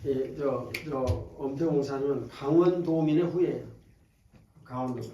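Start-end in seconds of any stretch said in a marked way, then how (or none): none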